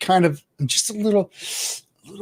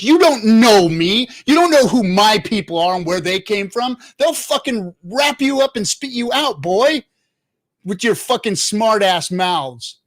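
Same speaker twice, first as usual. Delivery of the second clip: heated rant; 5 semitones higher than usual. Real, not acted. Streamer ranting at crypto influencers, angry and raised. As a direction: tell them off hard, voice climbing. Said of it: "that fucking fires me up".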